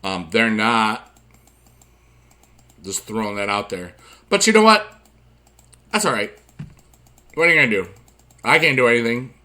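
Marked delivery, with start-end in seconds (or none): 4.3-6.3 perking up. Real, not acted. Sudden upbeat turn in rant.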